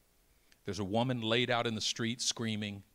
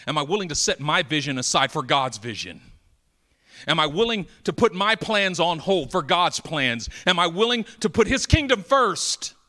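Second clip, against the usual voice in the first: loud, rising pulpit cry, higher each line; full-force preaching; 6 semitones higher, 12 dB louder than usual. Acted. Preacher to the congregation, loud and climbing. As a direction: preach it out to the whole hall.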